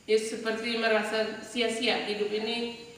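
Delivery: normal talking voice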